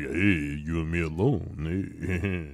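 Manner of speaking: low, soft lazy creep; Matt Dillon voice. Retold or imitated. Cohost voicing Matt Dillon to Lindsay, low lazy creep. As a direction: drop low and mumble it out.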